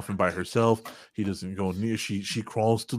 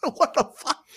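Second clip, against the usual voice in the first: laughing mock outrage.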